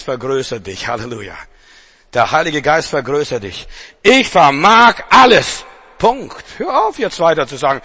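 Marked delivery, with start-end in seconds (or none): 4.0-6.4 louder, slow and emphatic; slow proclamation. Acted. Preacher hammers the verse home slowly and loudly. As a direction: lean on each word, proclaim it.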